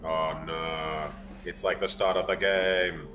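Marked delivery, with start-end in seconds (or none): none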